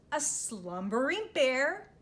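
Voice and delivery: ordinary delivery, nothing marked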